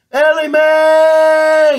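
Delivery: long, drawn-out high holler; yelling across a field. Acted. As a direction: call her name from four hundred acres away. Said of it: "hoping she can even hear him yelling in the distance".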